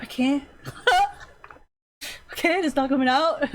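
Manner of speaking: laughing helplessly. Retold or imitated. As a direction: giggle as you admit you can't explain it. Artist can't explain, laughing.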